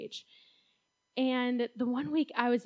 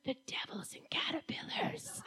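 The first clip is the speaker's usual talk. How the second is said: laughing whisper; 4 semitones higher, 12 dB quieter than usual. Retold or imitated. Child's takeaway, retold laughing.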